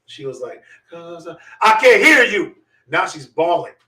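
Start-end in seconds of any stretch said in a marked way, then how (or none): 0.9-2.6 loud, drawn-out mock-sergeant yell; berating shout. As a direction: slowly yell at a crying child, imitating. Sergeant berates crying girl, retold.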